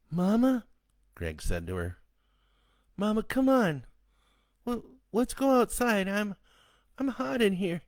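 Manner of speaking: put-on high child's voice, pleading; a little boy begging his mama; boy asking his mother to come outside; young boy voice. A narrator's reading. Boy calling his infected mother.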